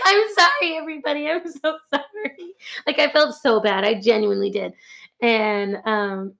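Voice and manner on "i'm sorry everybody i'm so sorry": high, fading guilty wail; mock-wailed apology. Departing worker apologising to coworkers, high guilty whine.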